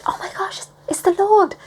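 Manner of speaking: high, hushed gasp rising into exclamation; awed excitement. Acted. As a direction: stammer it in thrilled disbelief. Acts disciples recognising Jesus.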